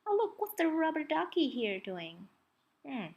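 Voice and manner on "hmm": ordinary delivery, nothing marked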